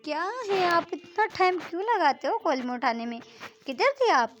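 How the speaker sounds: high, loud, exasperated scolding; a friend scolding over a late-answered call; annoyed scolding. Acted. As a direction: demand to know why she took so long.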